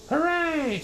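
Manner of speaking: high, triumphant cheer; joyful shout. Acted. Yertle perched forty miles high, high triumphant cheer. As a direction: whoop with triumph. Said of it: "shouted Yertle".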